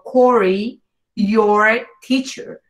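"Corey" with an English R, "your" slow and R-heavy; English pronunciation. Shown as a demonstration. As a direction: say "your teacher" the American way. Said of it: "en inglés sería como".

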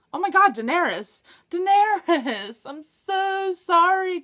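high, loud, drawn-out cry of delight; suddenly remembering your favourite; thrilled, slow, loud exclamation; excited outburst. Real, not acted.

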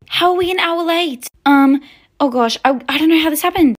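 high, loud flustered mother; flustered alarm. Acted. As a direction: react in panic to being late. Mother learns they are late.

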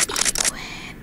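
a soft whispered "Equip". Real, not acted. Gamer quietly equipping the weapon. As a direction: say it under your breath.

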